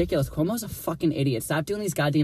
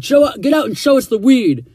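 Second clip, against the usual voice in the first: loud, high barking order from a cop; mock cop yelling a command; retold cop shout; 10 semitones higher, 8 dB louder than usual. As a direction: bark it like an impatient cop.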